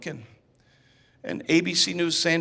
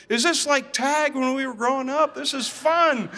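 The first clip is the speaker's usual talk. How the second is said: higher, playful teasing while chasing; lighthearted; 7 semitones higher than usual. Retold or imitated. Chasing his friend, playful and lighthearted. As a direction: keep it light, like a kid's game. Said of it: "I tried to make it lighthearted".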